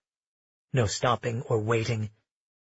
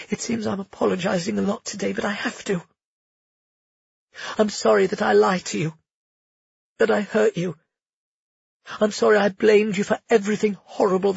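put-on higher, tearful earnest young woman; heroine voice; 7 semitones higher than usual. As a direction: pour out a heartfelt apology. Heroine's apology in story.

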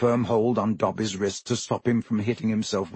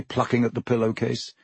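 ordinary delivery

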